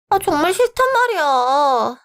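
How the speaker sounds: high, drawn-out little-sister whine; whiny refusal. Acted. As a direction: whine slowly and high in protest. Younger sister whines at nagging brother. (투정 부리는 말투)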